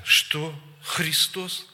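indignant challenge, pitch jumping up; retold angry questions, rising in force; aggressive. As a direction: snap back offended, mocking an aggressive woman. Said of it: "так агрессивно".

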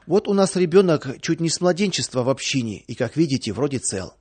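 ordinary delivery, nothing marked

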